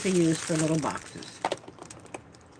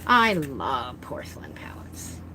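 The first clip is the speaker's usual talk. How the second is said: slow delighted whisper; 5 semitones lower, slower than usual. Real, not acted. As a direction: breathe it out lovingly. Delighted aside over a palette.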